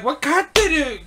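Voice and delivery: incredulous echo. Retold or imitated. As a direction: repeat "Wakateru?" as a baffled, high question. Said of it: "he's like".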